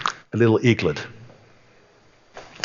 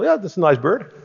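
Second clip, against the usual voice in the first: pleased farmer; 8 semitones higher than usual. Retold or imitated. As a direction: voice the happy farmer admiring his bird. Farmer voiced in story.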